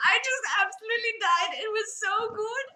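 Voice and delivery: high, breaking up with laughter; real laughing delight. Real, not acted. Telling of the Zarya cosplayer, laughing hard. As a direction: gush while recovering from laughter. Overcome by her own story.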